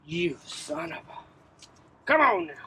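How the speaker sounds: frustrated strain mid-swing; forcing it out through effort; chopping wood. Real, not acted. Log won't split, swearing.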